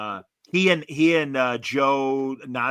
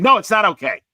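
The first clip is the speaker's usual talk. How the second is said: louder, higher mock snippy no; imitated curt refusal; 7 semitones higher, 11 dB louder than usual. Guy at the Hyatt refuses to share table, mock loud refusal. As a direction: play the petty guy guarding his table.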